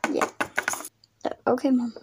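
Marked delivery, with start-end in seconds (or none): none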